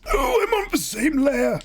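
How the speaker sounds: high, slow, shouted exclamation; surprised outburst. Real, not acted. Art streamer finding out she drew on the wrong layer, high shout. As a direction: cry out at the mistake.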